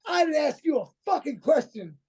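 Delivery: high, mock snap at the doctor; imitating a boss. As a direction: boss cutting the doctor off, hammed-up.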